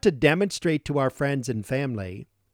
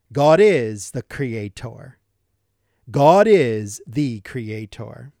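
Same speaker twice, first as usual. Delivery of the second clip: louder, slow, weighty declaration; emphatic proclamation; 6 dB louder, slower than usual. Acted. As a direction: proclaim it slowly, each word landing.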